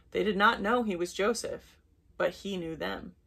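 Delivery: ordinary delivery, nothing marked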